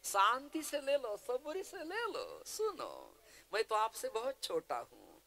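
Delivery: placating nephew-minded schemer, high wheedling plea; Shakuni. Acted. Shakuni begs grandsire Bhishma to hear him out, high placating plea. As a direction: plead with the elder in a high, swooping voice. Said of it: "आंधळ्या धृतराष्ट्राचा आणि शकुनीचा".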